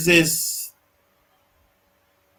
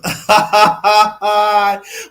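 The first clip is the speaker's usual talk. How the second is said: louder burst of laughter; hearty laugh; 3 semitones higher, 5 dB louder than usual. Real, not acted. Laughing after joking about the passports. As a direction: crack up at your own joke.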